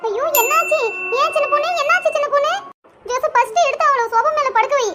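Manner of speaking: high, urgent cry of concern; alarmed. Acted. Mother-in-law rushing to help dizzy daughter-in-law, alarmed.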